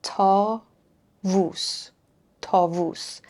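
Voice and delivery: "Ta vus" split into syllables, then joined. Shown as a demonstration. Teacher splitting a word into syllables for students, slowly. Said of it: "we have the vav twice here".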